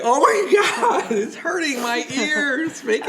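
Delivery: high, loud, drawn-out fake agony; playful over-the-top complaint, laughing; mock pained wail. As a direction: wail slowly as if the volume hurts, hammed-up. Acting out painfully loud headphone volume.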